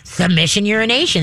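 ordinary talk, nothing marked

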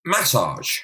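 massage, first A emphasised; massage stressed on first syllable. Shown as a demonstration. Host correcting co-host, massage stressed on the first A. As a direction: demonstrate massage with a front-stressed MASS. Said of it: "The emphasis should be on the first A".

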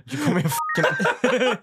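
high, outraged mother; shrill-edged mom, offended. Retold or imitated. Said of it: "Did she call you a heifer?".

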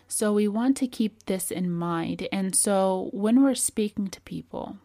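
ordinary delivery

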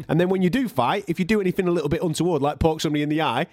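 ordinary talk, nothing marked

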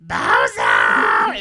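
deep, loud clown shout; Bozo the Clown. Retold or imitated. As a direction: bellow the name like a scary clown. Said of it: "I'll come out as Bozo".

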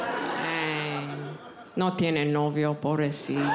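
put-on low, slow old abuela pity; pitying great grandma. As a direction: drag out pobrecita with pity. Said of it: "my great grandma's voice".

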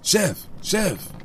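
high, called out Chef Chef; sing-song call for the chef. Acted. Comic ad skit, calling out.

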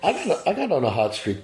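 hoarse, catchy throat; a frog caught in the voice. Real, not acted. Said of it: "I got a frog in my throat".

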